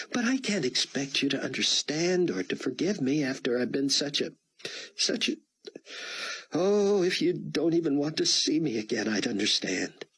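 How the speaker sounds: put-on a tearful young woman owning up; Chloe. A narrator's reading. Chloe confesses to Buck, high and tearful.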